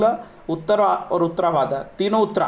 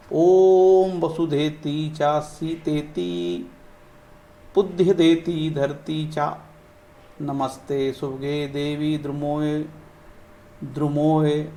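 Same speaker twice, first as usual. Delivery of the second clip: slow mantra recitation; 3 semitones lower, much slower than usual. Acted. Teacher reciting a tree-planting mantra for viewers, slow chant. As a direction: chant each Sanskrit word slowly and deliberately.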